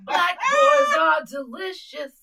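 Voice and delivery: slow, loud playful declaration; loud drawn-out. Real, not acted. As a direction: stretch it out loudly.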